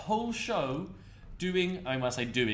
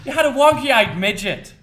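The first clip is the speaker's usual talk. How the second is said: loud, higher-pitched Irish accent; 7 semitones higher, 12 dB louder than usual. Shown as a demonstration. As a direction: belt it out in a put-on Irish accent. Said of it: "in an Irish accent".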